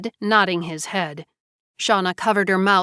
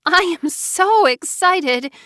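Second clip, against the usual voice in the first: high, slow, giddy gushing; excited giggle; 10 semitones higher, 3 dB louder, slower than usual. A narrator's reading. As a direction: gush like a thrilled girl. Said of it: "giggled Shauna".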